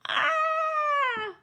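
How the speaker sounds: long, high, loud wail; troll howl. A narrator's reading. Troll knocked into the river, high howl. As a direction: howl as you are swept off. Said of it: "howled the troll".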